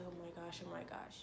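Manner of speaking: low, hushed panicky repetition; anxious self-talk. Retold or imitated. Retelling sweaty-handed anxiety to viewers, hushed anxious muttering. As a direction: murmur it over and over under your breath. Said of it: "your heart's racing and everything".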